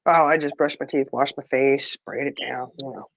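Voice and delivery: ordinary delivery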